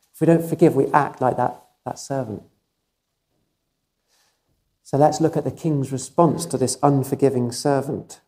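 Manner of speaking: ordinary talk, nothing marked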